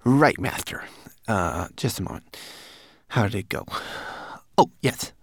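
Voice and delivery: high, hesitant little mannequin; nervous servant. Acted. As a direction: stall for time timidly.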